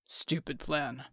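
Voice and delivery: very low, slow, strained rumble; deep slow voice. A narrator's reading. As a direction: drag it out, deep and heavy. Bull's voice.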